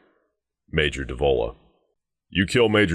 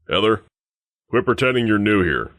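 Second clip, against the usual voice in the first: higher, raised guard's reprimand; calling out; 6 semitones higher, 3 dB louder than usual. Acted. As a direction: call across to someone you know, impatiently. Guard calls out to Heather.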